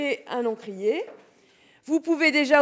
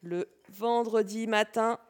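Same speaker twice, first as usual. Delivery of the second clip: dictating; 3 semitones lower, 4 dB quieter than usual. Shown as a demonstration. Teacher dictating, repeating.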